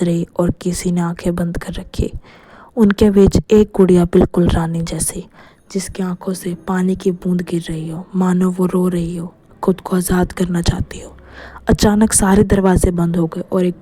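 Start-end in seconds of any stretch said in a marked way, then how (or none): none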